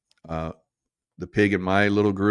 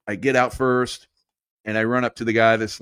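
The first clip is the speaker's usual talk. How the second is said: usual speaking voice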